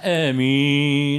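"آمين" held long and loud on one level note; loud, sustained congregational "آمين"; drawn-out Amin. Retold or imitated. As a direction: imitate a congregation stretching the last syllable of "آمين". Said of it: "يطولوها على الآخر".

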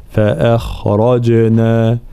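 فأخرجنا with a light hamza, heavy خ and ر, and a bouncing ج; slow recitation of فأخرجنا; tajwid demonstration. Shown as a demonstration. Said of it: "ترقيق الهمزة، ثم الخاء مفخمة".

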